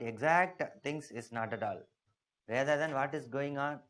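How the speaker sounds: ordinary talk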